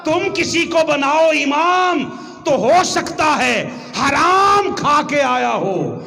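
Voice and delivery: slow, high, loud preaching shout; drawn-out shouted admonition; forceful warning. Acted. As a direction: drive each phrase home at full force. Preacher warns at full force.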